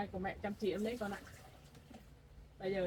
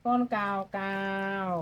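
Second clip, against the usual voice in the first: slow; 9 dB louder, much slower than usual. Shown as a demonstration. Teaching baby the word.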